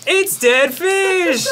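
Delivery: high, slow, dismayed exclamation; horrified cry. Real, not acted. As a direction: shout it out in disgusted shock. Horrified at dead fish bean.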